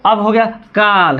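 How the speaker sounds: काल with a slow, drawn out आ; slow demo of काल; long vowel. Shown as a demonstration. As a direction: stretch the आ in काल. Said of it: "अब देखिए कल".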